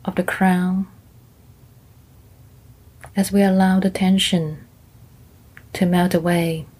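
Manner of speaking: gentle meditation guide; guided meditation. Acted. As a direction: ease them into calm, one body part at a time.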